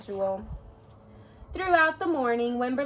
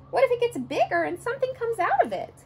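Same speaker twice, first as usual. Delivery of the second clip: put-on anxious child; 10 semitones higher, faster than usual. Wimberly's worried question.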